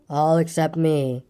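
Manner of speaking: slow, low, gloomy donkey voice. Acted. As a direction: drag it out like a mopey donkey. Gloomy Eeyore.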